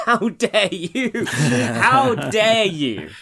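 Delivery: laughing, high, drawn-out how dare you; hammed-up outrage at a smug columnist; mock outrage. Mocking the columnist, loud stretched outrage.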